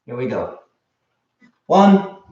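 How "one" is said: loud barked count; sharp martial arts commands. Real, not acted. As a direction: call the rep count out sharply. Counting reps in drill.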